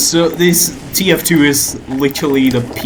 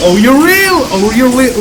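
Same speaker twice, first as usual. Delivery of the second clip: shocked, pitched way up; high surprised yelp; 10 semitones higher, 4 dB louder than usual. Real, not acted. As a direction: squeal of sudden disbelief. Mock outrage at teammate.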